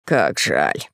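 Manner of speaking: sighing nobleman; fat count voice. A narrator's reading. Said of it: "сказал толстяк".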